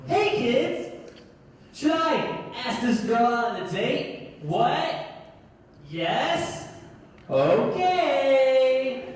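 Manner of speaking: high, exaggerated kids' show presenter; children's TV host. Host asking child viewers for advice, hammed-up and singsong. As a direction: ask the little viewers, pitched high with big pauses. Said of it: "act like I'm on a child's T V show".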